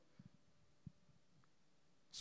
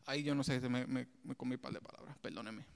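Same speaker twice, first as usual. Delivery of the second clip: a quick under-the-breath apology; muttered aside; 8 semitones lower, 10 dB quieter than usual. Real, not acted. Preacher apologizing to the church under his breath, soft. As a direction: drop low and soft, apologizing.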